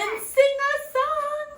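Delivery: high, loud, happy request. A narrator's reading. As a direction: pipe up high like a happy dwarf. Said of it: "says the happy dwarf".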